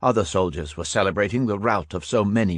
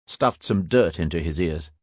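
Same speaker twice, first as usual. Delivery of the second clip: usual speaking voice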